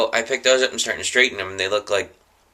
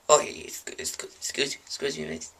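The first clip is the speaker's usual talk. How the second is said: softer, hesitant foreign cleaner; timid quiet; 5 semitones higher, 8 dB quieter than usual. Acted. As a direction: stammer it timidly to the lady. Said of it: "I'm like".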